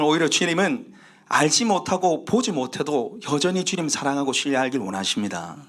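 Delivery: usual speaking voice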